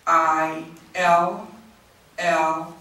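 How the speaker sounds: very slow, letter by letter; spelling out slowly. Real, not acted. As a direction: spell it out with long gaps between letters. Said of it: "If I'm talking that slowly".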